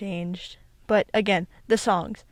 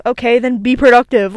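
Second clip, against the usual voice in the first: mock blunt retort; 2 semitones higher, 10 dB louder than usual. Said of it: "It's like".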